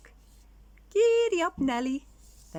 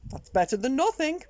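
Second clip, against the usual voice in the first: high, louder little skeleton proposing a game; 8 semitones higher, 5 dB louder than usual. Acted. Little skeleton to big skeleton, eager high voice. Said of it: "he said".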